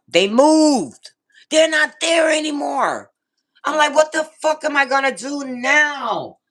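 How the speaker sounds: high, loud retold outrage; exasperated outburst. Retelling finding bike shop gone.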